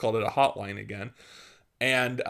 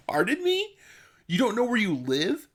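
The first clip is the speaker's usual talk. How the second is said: much higher, incredulous pardon me; officer's disbelief; 10 semitones higher than usual. Retold or imitated. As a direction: play the cop stunned by the answer. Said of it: "And he's like".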